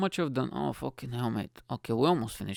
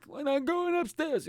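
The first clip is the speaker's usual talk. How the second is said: slow, high-pitched mock parent scolding; hammed-up parent yell without the volume; 14 semitones higher than usual. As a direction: draw it out high, imitating a fed-up parent. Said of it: "just fucking yelling and shit".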